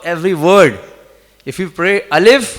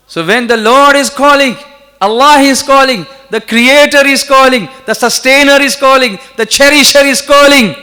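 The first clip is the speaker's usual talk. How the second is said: high, loud sermon climax; impassioned preaching; 7 semitones higher, 9 dB louder than usual. Real, not acted. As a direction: build to ringing, parallel proclamations.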